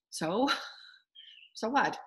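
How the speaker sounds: slow, amused "so what" through a laugh. Real, not acted. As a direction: shrug it off with a chuckle. Laughing off past hurt.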